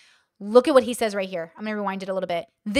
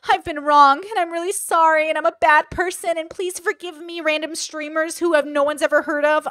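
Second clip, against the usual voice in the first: higher, louder whiny mock apology; mock groveling apology; 5 semitones higher, 4 dB louder than usual. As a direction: ham up a pleading, groveling apology. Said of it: "they wanna hear her say".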